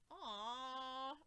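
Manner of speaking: drawn-out sympathetic aw; sad "aw". Retold or imitated. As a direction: let out a long, touched whine. Saddened reaction to the scene.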